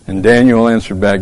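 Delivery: ordinary delivery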